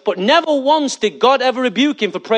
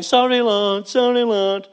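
mock crying; 2 semitones higher, slower than usual. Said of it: "I can hear you crying".

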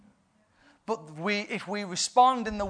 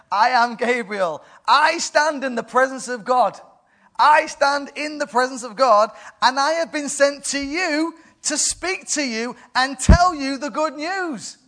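booming Gabriel; 8 semitones higher, 7 dB louder than usual. Acted. Gabriel declares himself to doubting Zechariah, booming. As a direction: announce yourself like a mighty angel. Angel proclaims his authority.

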